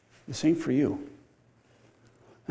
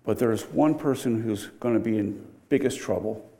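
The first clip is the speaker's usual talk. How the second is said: ordinary delivery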